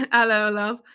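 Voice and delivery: a quick, light mock-British hello, love; British accent. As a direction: playfully slip into a British greeting. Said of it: "You like how I said it?".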